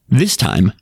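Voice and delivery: ordinary delivery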